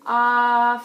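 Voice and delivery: loud, long, open ah; saying ahh. Shown as a demonstration. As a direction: hold a loud ah. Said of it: "I want you to say".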